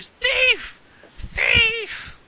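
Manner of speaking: put-on high, loud shrieking old woman; screeching old crone crying thief; old woman yelling. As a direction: shriek it like a robbed old lady.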